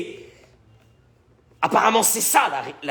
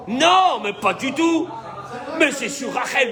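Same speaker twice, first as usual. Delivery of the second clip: high, emphatic indignant protest; acted annoyance; 5 semitones higher than usual. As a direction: object hotly, pitch jumping up. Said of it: "entre guillemets, s'énerver". (protestation énervée, jouée, voix aiguë)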